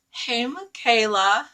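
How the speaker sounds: very high, slow mock-sweet hello; high sugary petty hey; petty sing-song greeting. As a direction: taunt her with a sugary greeting.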